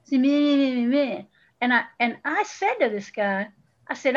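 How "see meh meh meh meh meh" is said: mocking bleat. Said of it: "mocking the questioner".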